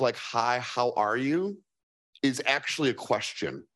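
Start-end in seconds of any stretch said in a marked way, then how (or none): 0.2-1.6 slow, drawn-out genuine hello; unhurried, earnest Midwest greeting; slow sincere greeting. Retold or imitated. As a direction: ask it like you really want to know. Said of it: "the Midwest thing of".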